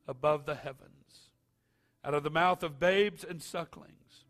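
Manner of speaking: solemn read-aloud scripture. Acted. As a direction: read it like Bible verse.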